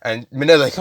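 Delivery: plain reading voice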